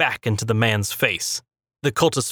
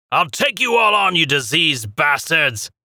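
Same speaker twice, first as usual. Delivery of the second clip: battle cry; 5 semitones higher than usual. A narrator's reading. Errol charging with mad grin.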